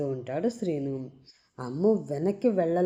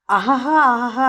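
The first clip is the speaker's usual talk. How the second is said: put-on loud drunken sobbing; 4 semitones higher, 7 dB louder than usual. Srinu sobbing loudly over his beer bottle. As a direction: sob it out loud like a drunk man. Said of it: "మధ్య మధ్యలో గట్టిగా ఏడుస్తూ".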